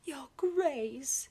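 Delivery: startled nurse exclaiming. Acted. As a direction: cry out to stop the Duke.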